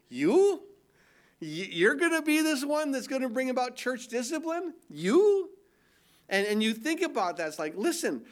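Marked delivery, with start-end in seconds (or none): 0.1-5.5 mock disbelief. Mocking Paul's weak presence.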